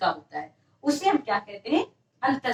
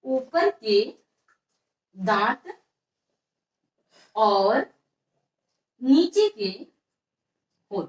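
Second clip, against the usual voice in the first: dictation; 3 semitones lower, much slower than usual. Shown as a demonstration. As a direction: piece by piece with long pauses. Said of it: "तो यहाँ पास लिख लीजिए".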